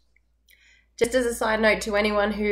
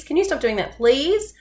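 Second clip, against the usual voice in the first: high pleading question with "please" rising up; pleading rise; 7 semitones higher than usual. Shown as a demonstration. Teacher demoing a pleading request to kids. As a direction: beg it, voice climbing on "please". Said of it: "your voice is going up on that please".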